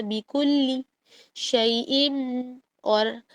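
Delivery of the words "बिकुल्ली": ordinary delivery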